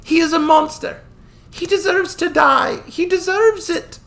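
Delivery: put-on high young voice, bitter and tearful; a young woman demanding revenge for her brother; grieving girl. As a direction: spit out the hatred through grief. Lisha's voice in the story.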